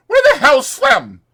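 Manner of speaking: Curly hunting Slim, furious shout; threatening shout. Acted. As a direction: bellow for Slim like you want a fight. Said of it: "Curly looked threateningly about the room".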